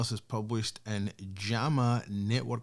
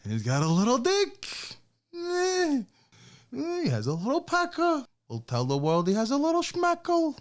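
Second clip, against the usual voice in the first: high, loud, sneering gossip voice, way up in pitch; mock propagandist; 4 dB louder than usual. Mocking Hitler rumor-mongers in a high sneering voice. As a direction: ham up a scheming rumor-spreader.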